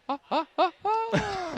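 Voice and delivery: very high imitated laugh; high, evenly spaced mock honking laugh; Jimmy Carr. Comedian impersonates a comic by his laugh, high-pitched. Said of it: "impersonation is just his laugh".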